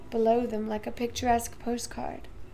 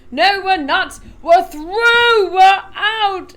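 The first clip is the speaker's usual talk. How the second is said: loud, higher cry of victory; triumphant shout; 6 semitones higher, 14 dB louder, much slower than usual. A narrator's reading. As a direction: announce the breakthrough. Wonka triumphant.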